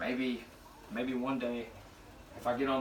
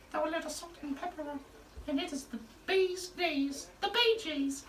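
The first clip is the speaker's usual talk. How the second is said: much higher falsetto celebrity host voice; 13 semitones higher, 4 dB quieter than usual. Retold or imitated. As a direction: float it up high like a disco falsetto host. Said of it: "he was like".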